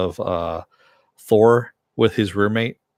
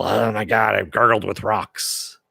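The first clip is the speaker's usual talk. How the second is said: a gravelly mock Batman growl, slow; mock Bale Batman; slower than usual. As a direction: growl it like you've gargled with rocks.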